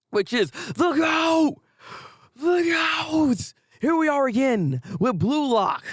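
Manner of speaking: drawn-out high announcer call of the goat; hyped announcement. Acted. Vlogger hyping the next anime like an announcer, drawn out and high. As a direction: bellow it like a ring announcer.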